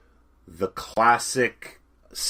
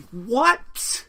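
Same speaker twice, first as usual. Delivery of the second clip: surprised; 5 dB louder than usual. Real, not acted. Shocked at stupid robbery.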